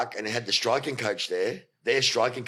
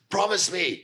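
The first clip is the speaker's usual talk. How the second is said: higher-pitched mock demand; imitated telling-off; 7 semitones higher, slower than usual. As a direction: imitate a boss laying down the law. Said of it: "giving it to all of us".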